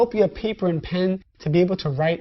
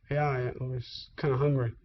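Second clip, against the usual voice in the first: sheepish mumble; 7 semitones lower, 8 dB quieter than usual. Retold or imitated. Sheepish self, quiet.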